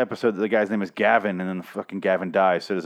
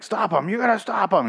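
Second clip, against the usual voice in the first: pitched way up, urgent pleading yell; mock yell; 10 semitones higher than usual. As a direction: yell at Dwight to stop him. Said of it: "Michonne starts to yell at Dwight".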